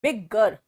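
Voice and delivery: बिगर with a doubled g, loud and held; clear demo. Shown as a demonstration. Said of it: "आपको वो सुनाई भी देना चाहिए".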